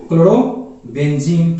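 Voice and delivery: Chlorobenzene stretched slowly syllable by syllable; teacher drawing out chlorobenzene so students follow; slow, drawn out. Shown as a demonstration. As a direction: spell out chlorobenzene slowly for the class. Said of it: "क्या हो जाएगा बाबू?".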